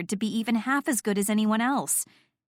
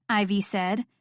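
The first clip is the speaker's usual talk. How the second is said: ordinary delivery, nothing marked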